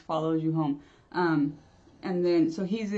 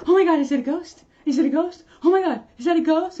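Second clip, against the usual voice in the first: mock panic; 8 semitones higher, 8 dB louder, faster than usual. Mocking a panicked ghost-seer.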